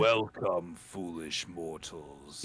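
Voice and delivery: low, slow, sinister host voice; dark lord. Acted. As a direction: draw it out like a haunted mansion ghost host. Quoting Haunted Mansion host.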